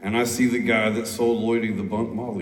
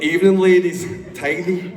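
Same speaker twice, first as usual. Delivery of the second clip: cheeky Scottish greeting; 8 semitones higher, 4 dB louder than usual. Retold or imitated. Imitating Craig greeting women.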